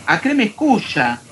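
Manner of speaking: ordinary delivery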